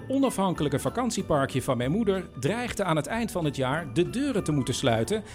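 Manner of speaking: ordinary talk, nothing marked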